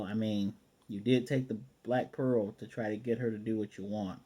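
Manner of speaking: ordinary talk, nothing marked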